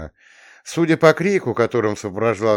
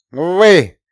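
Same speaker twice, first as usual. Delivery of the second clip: loud call; 9 semitones higher, 11 dB louder than usual. Acted. Counselor calls out to group.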